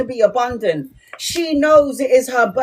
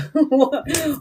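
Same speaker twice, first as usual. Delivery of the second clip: laugh; 4 semitones higher than usual. Real, not acted. Laughs at own bit.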